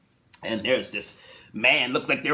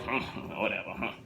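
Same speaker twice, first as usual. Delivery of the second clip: bored grunt; 5 semitones lower, 10 dB quieter than usual. Retold or imitated. Husband unimpressed.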